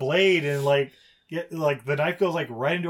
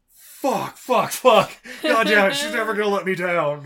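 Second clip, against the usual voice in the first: loud, rising hammed-up swearing; mock frustrated cursing; 5 semitones higher, 7 dB louder than usual. The director cursing over the DNA results, a loud mock rant. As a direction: curse louder and louder.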